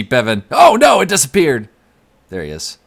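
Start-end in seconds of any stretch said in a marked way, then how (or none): 0.5-1.7 high, loud sudden alarm; surprised yelp. Real, not acted. Streamer losing a viewer's name in chat, surprised.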